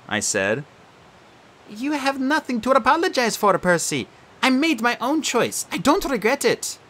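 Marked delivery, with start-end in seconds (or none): 1.7-6.8 put-on calm her old friend's guilt; gentle girl ghost. Bianca's voice.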